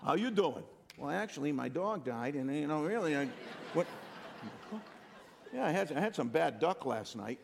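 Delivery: lightly voiced oversharing local; chatty Southern neighbor. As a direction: play the neighbor who tells you everything. Southerners answering the greeting at length.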